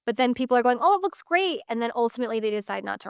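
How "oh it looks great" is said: higher, imitating pleased house viewers; mock enthusiasm. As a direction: gush lightly like a polite viewer. Acting out prospective tenants' gushing reaction.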